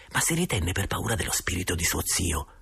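ordinary delivery

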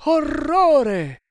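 high, loud cry of horror; horrified exclamation. A narrator's reading. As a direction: gasp it out in horror. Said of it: "esclamarono gli indiani".

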